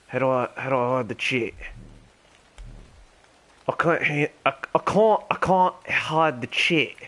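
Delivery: terrible mock Australian accent; Ringo the Dingo. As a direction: chat along in a badly faked Australian accent. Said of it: "my terrible Australian accent".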